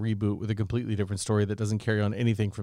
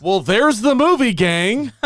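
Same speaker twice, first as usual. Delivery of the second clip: booming, much higher announcer voice; radio announcer sign-off; 10 semitones higher, 7 dB louder, slower than usual. Shown as a demonstration. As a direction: wrap it up like a showman. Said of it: "my radio announcer voice".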